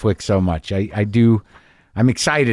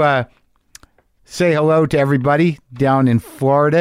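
ordinary delivery

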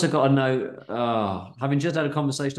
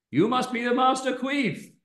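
much higher Indian voice; cheeky punchline in Indian accent; 10 semitones higher than usual. Acted. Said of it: "gonna plump on Indian".

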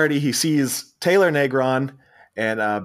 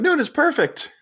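higher, eager, hammed-up foreign-sounding rich kids; pushy rich siblings. Brazilian siblings butting in on Chevy, pitched higher and eager. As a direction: barge in with a brightly pushy invite. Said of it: "the Brazilianaires pop back up and they're like".

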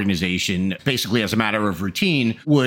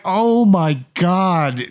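mock shock; 7 semitones higher, 4 dB louder, much slower than usual. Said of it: "I'm like".

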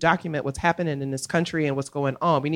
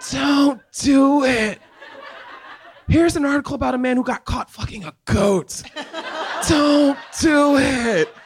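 mock warning shout; 10 semitones higher, 5 dB louder than usual. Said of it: "now I'm like".

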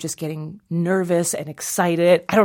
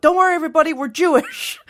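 high, loud mock announcement; mother yelling out; 11 semitones higher, 4 dB louder, faster than usual. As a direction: call out to a whole crowd. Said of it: "wasn't gonna yell out".

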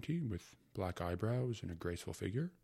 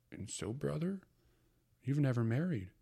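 higher, earnest mother; 7 semitones higher, 3 dB louder than usual. Acted. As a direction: ask like a worried sister. Mother's voice in story.

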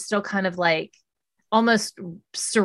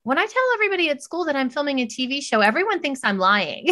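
a hint of a young girl, higher and fast; little daughter; 6 semitones higher, faster than usual. Daughter tells mom classmates think she lies, lightly voiced child. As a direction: rattle it off like an excited little kid. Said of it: "'cause she's little, you know, and she's like".

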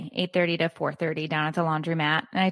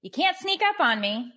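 exasperated scolding; 9 semitones higher than usual. Real, not acted. Scolding person who walked in.

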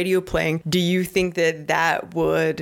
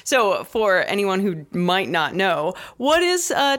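ordinary delivery

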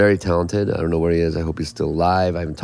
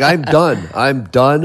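louder, higher, fed-up outburst; retold anger; 5 semitones higher, 4 dB louder, much slower than usual. Musician retelling quitting the band on tour, angry. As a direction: re-enact quitting in a huff. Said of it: "I was so angry".

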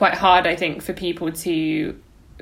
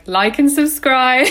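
youtuber sign-off; 7 semitones higher, 6 dB louder, slower than usual. Pretend. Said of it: "that thing now as well of being like".

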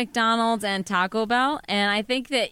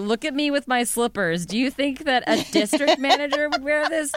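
louder, higher, mock-important boss; pompous manager; 5 semitones higher, 4 dB louder than usual. To the service desk, claiming to be regional manager, mock-pompous. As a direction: puff yourself up as the big boss. Continues the fake manager bit.

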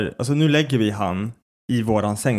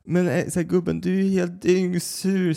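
slightly higher, drawn-out voice of the partner; imitated partner; 6 semitones higher than usual. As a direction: gently imitate a sleepy partner addressing a child. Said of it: "Och Becka typ bara". (lätt härmad sambo, ljusare röst)

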